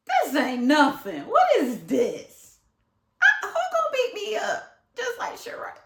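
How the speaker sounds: high, loud, sassy dismissal; mock tough-guy sass; cocky bravado. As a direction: brush off the threat with swagger. Mocking bravado at school.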